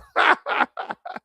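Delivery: a breathy laugh pitched well up; high airy laugh. Real, not acted. Streamer laughing at his own six-hour stream, airy high laugh.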